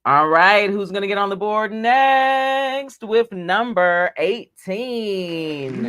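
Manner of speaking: slow, drawn-out bingo call; bingo caller. Acted. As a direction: stretch it out like a bingo host. Bingo caller announcing number.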